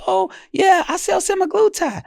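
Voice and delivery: high bright sales voice; mock seller. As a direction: chirp it like a salesman. Imitating a provider's sales line.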